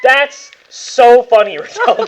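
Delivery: loud, high, slow laughing delight. Real, not acted. GM laughing at a player's dice roll, loud. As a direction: savour the bad roll with a laugh.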